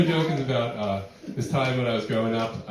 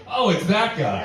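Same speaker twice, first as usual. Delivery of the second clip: mock recognition; 7 semitones higher, 5 dB louder, slower than usual. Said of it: "you'd be like".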